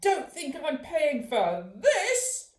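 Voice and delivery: slow, loud indignant snap; angry roar. A narrator's reading. Mum roaring at Mister Smith over her shoulder. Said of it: "roared Mum".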